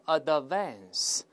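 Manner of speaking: "advance" with an extra schwa after the D; wrongly released D. Shown as a demonstration. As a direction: say "advance" as a-duh-VANS. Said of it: "那个的是个失去爆破".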